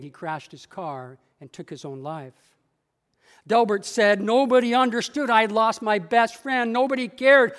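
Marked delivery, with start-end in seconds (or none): 4.2-7.5 loud, raised-voice lament; acted bitter complaint, pushed louder; aggrieved outburst. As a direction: protest the loss forcefully, as if nobody listened. Acted lament over lost best friend.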